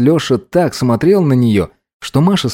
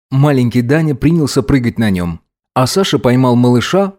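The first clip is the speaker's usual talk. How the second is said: usual speaking voice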